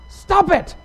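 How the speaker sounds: sudden high shout; skit therapist's yell. Retold or imitated. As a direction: yell it at the patient, high-pitched. Therapist's abrupt yell in retold skit.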